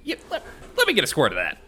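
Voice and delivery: fast, high, pretend-keen request; mock eagerness. Joking along about taking sanitizer, mock eagerness. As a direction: jump in quickly, playing overeager.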